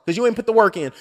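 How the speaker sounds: ordinary delivery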